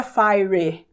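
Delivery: "fire" misread as FY-ree, with an added final vowel; mispronunciation. Shown as a demonstration. Teacher showing learners what not to say, mispronounced. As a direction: say "fire" wrongly, ending in a Portuguese "ree". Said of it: "Não diga fire".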